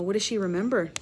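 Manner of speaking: plain reading voice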